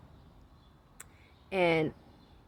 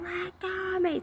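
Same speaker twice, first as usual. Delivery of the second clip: retold scream; 11 semitones higher, 6 dB quieter than usual. Pinned girl screaming at the counselor, high mock yell. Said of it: "and she's screaming".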